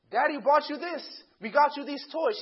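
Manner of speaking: loud, high acted dad; indignant protest. Dad listing gifts to his daughter, indignant protest.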